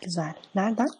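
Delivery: soft, quiet put-on woman's voice; man playing a woman softly on the phone; man doing a woman's voice. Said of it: "Oğlum ben kadın sesi yapamam ki".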